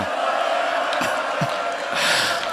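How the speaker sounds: breathy choked wail; a broken sob of grief; anguished sob. Acted. Wail after plea at grave.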